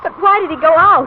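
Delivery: high, loud, frightened question; frightened daughter crying out in the storm; fearful cry. Acted. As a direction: cry out in worry. Father missing in storm.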